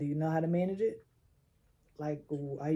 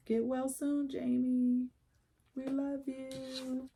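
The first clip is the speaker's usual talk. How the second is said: higher, slow, tender well-wishing; tender get-well wish; 6 semitones higher, 5 dB quieter, slower than usual. Real, not acted. Reader to ailing [name], tender and slow. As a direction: send him love gently and slowly.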